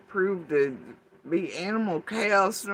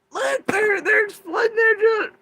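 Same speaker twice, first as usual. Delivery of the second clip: high, loud sudden shout; excited shout; 10 dB louder than usual. Real, not acted. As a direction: blurt it out in alarm. Excited outburst at something seen.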